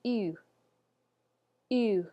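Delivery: ew rounded like French U; French U sound. Shown as a demonstration. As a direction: model the French U as ew. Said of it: "let's go ahead and say that".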